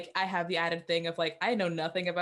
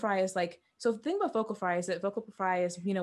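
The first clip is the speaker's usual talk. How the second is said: usual speaking voice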